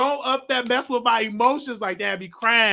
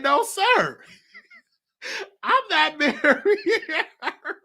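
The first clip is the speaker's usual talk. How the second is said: high, drawn-out refusal through laughter; laughing refusal; 9 semitones higher, much slower than usual. Retold or imitated. Telling uncle no to marrying her, laughing. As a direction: crack up while refusing, stretching the words.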